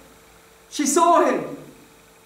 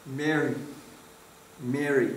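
tender; 8 semitones lower, 8 dB quieter than usual. Acted. Jesus calling Mary by name.

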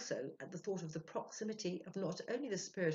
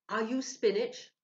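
raised, loud, slow questioning voice; Ludwig's eager question; 7 semitones higher, 11 dB louder than usual. Acted. Ludwig asking the spirit at the seance, loud and high.

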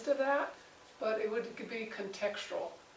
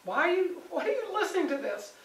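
exasperated question; 7 semitones higher, 3 dB louder than usual. Retold or imitated. Paul exasperated with them.

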